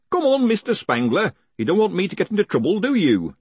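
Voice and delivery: high, quick wheedling; coaxing hangman. Acted. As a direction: coax the prisoner along. Hangman coaxes condemned man.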